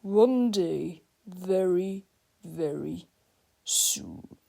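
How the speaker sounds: menacing vow sinking low and fading to a hiss; sinister vow. Acted. Principal vows revenge on the boys, sinking to a sinister hiss. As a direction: savour every word like a villain's promise. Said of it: "Mister Krupp vowed".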